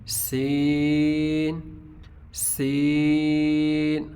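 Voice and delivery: سین held slowly, light with a whistling s; thin seen. Shown as a demonstration. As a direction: stretch سین thin and hissing. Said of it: "sound باریک ہوگی لیکن سیٹی کی آواز".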